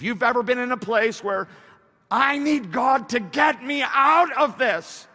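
high, loud, slow cry for rescue; full-force preaching. Acted. Preacher voicing the trapped believer's need, full-force preaching. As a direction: build to a belted plea.

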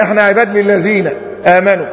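ordinary delivery

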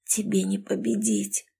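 soft, hoarse half-whisper. Acted. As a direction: rasp it with a crushed throat. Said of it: "сиплым голосом прошептала". (сиплый шёпот)

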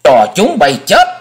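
loud high shout like a demon; demonic scream. Acted. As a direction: scream it like a raging devil. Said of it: "chàng thét lên như loài quỷ dữ". (thét lên như quỷ dữ)

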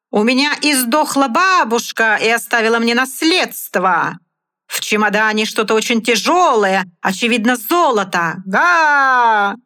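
high, loud clown patter; booming circus ringmaster. Acted. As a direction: play to the circus crowd. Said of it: "стараясь перекричать рёв".

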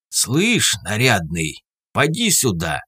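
raspy, husky street call; hoarse call. Acted. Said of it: "окликнули меня сбоку сиплым голосом".

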